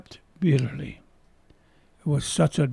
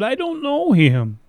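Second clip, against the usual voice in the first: high-pitched acted protest; insistent acted disavowal; emphatic denial; 9 semitones higher, 4 dB louder than usual. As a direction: deny it firmly, pitch jumping up then falling. Acting out Peter's denial.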